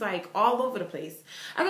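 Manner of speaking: ordinary talk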